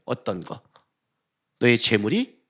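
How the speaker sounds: usual speaking voice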